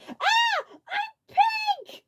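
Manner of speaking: very high, loud scream; horrified scream. A narrator's reading. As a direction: shriek in panic. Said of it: "he screamed".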